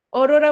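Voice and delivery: অরোরা loudly with closed o, "orora"; wrong closed vowel. Shown as a demonstration. Teacher tests students with the wrong form, অরোরা as "orora". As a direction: punch out অরোরা, first vowel like ও. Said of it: "অরোরা বলি না".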